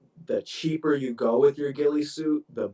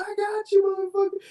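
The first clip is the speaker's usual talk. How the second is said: high-pitched mock sniper yell; squealed impression of a yelling sniper; imitated yell; 3 dB louder, faster than usual. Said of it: "You can hear the sniper yelling".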